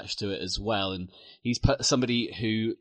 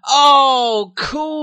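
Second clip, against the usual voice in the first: excited; 12 dB louder than usual. Real, not acted. Excited reaction to Grima Wormtongue.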